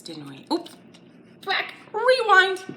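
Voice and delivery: normal talking voice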